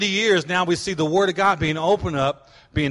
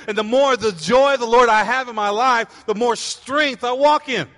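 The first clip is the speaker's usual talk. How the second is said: fervent preaching; 4 semitones higher, 4 dB louder than usual. Real, not acted. Preaching at full force again.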